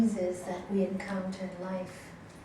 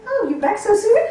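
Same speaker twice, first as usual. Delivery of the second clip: higher, louder sarcastic jab; sarcastic mock greeting; 11 semitones higher, 9 dB louder than usual. Teacher imitating a sarcastic spouse greeting a late partner. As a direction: tease them for coming back early. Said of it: "They didn't miss you?".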